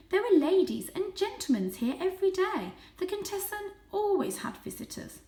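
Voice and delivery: put-on high, fond old aunt voice; aunt recalling the Contessa's visitors, high and fond; reminiscing aunt cat. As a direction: remember the old days fondly.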